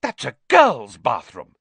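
loud, scandalised exclamation; gasped shock. A narrator's reading. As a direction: exclaim it, appalled. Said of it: "he gasped".

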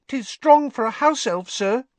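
high squeaky elf voice; earnest little elf insisting; house elf voice. A narrator's reading. Dobby voiced in narration.

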